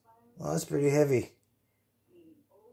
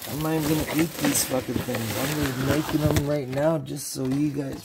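ordinary talk, nothing marked

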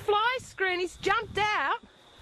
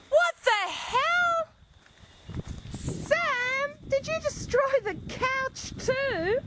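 disbelieving high outcry; 8 semitones higher, slower than usual. Real, not acted. Finds the destroyed couch.

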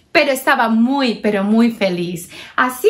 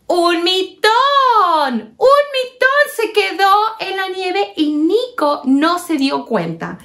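excited alarm; 7 semitones higher than usual. A narrator's reading. Narradora exclama la pérdida.

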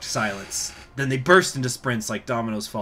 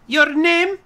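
high squeaky commander; 9 dB louder than usual. Acted. Marshal questions sergeant.

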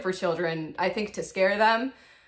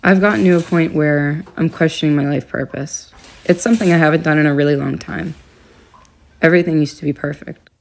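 dramatic confessional monologue; 4 semitones lower, 9 dB louder than usual. Acted. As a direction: deliver it like a moody film narration. Dramatic mock-diary monologue, loud.

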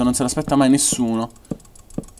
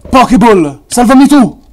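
shout; 11 semitones higher, 12 dB louder than usual. Real, not acted. Desperate plea while falling.

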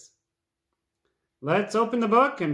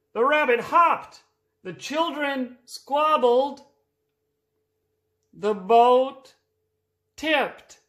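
building excitement; 6 semitones higher, slower than usual. A narrator's reading. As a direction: build the suspense, line by line. Story builds to the climax.

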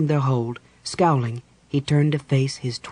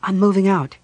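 raised, higher-pitched declaration; firm retort; 6 semitones higher, 5 dB louder than usual. A narrator's reading. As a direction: announce it loudly and firmly. Quarrel in the tent.

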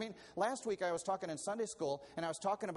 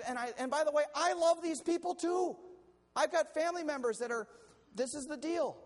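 1 impassioned; 10 semitones higher, 5 dB louder than usual. Real, not acted. Preacher stirred by own point.

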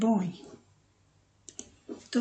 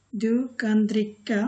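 Du kan dricka said slowly, word by word; slow model sentence; much slower than usual. Shown as a demonstration. Teacher repeats the example slowly for learners. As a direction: drill it slowly for the class. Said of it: "teacher models Swedish sentence".

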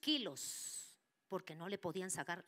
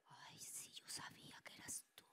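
relieved whisper; 14 dB quieter than usual. Retold or imitated. Mother answers the spirit.

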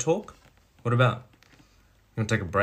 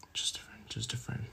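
whispered echo, very soft; menacing whisper; 14 dB quieter than usual. Acted. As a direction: whisper it back under threat. Mocking echo while threatening.

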